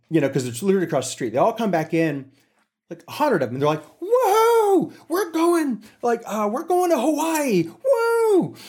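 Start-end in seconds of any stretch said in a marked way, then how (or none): 4.0-8.5 put-on drunk whooping crew. Drunk crew bragging to Bob about Hawaii, high whooping glee. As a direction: whoop it up like tipsy partygoers.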